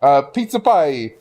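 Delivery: slow hammed-up Italian "pizza pie"; exaggerated Italian accent. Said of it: "You want me to be racist? Sure".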